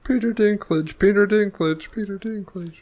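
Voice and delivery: high, exaggerated name calling; mock scolding. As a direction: call out Peter Dinklage in mock dismay. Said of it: "You didn't even deliver it well".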